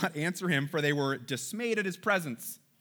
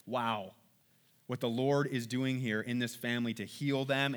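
usual speaking voice

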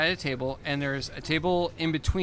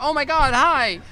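excited greeting; 10 semitones higher, 5 dB louder than usual. Retold or imitated. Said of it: "and they'll be like".

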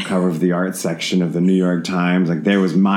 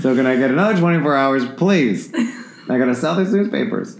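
mock plea; 7 semitones higher than usual. Pleading with Verizon, joking.

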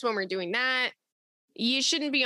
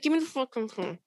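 angry; faster than usual. Retold or imitated. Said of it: "when you're all mad".

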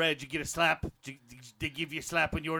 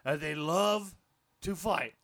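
fake Aussie accent; 4 semitones higher, 4 dB louder than usual. Aussie bloke warning the Englishman.

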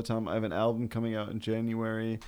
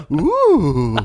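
ghostly ooh; 7 semitones higher, 16 dB louder than usual. Pretend. Ghost bit continues.

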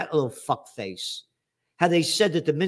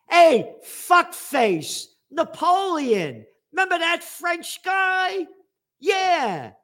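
much higher, drawn-out jeering voice; slow, sneering, high taunt; mocking taunt; 12 semitones higher, 3 dB louder, much slower than usual. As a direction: needle them slowly in a high mocking voice. Taunting France about Napoleon.

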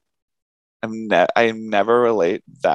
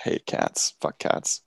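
breathy whispered curse under the breath; a low-voiced whispered aside of disgust; whispered grumble; 8 dB quieter, slower than usual. Real, not acted. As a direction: mutter it away from the mic as a whisper. Grumbled aside about hating cats.

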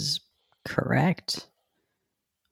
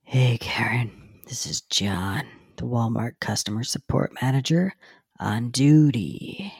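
whispered; 5 dB quieter than usual. Acted. Manager voice, breathy.

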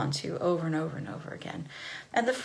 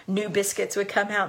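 usual speaking voice

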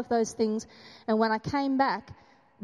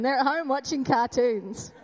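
ordinary delivery

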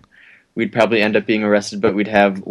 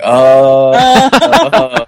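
sudden realization; 3 semitones higher, 9 dB louder than usual. Retold or imitated. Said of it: "And I was like".

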